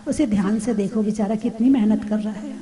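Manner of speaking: soft, pitying murmur over a poor angry man; pitying softly. Shown as a demonstration. Teacher shows pity for someone shouting at you, softly. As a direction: say it gently, as if feeling sorry for him.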